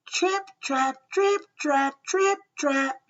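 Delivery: high, rhythmic trip-trap; little hoofsteps. A narrator's reading. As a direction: chant the small hooves' beat. Little goat's hooves on bridge.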